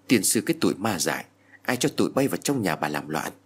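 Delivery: ordinary delivery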